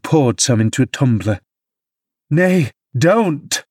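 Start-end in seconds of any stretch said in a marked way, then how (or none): 2.3-3.6 higher, pleading plea; entreating. Acted. Nurse begging the father not to drink, pleading and higher. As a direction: beg him to stop. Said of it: "I entreated".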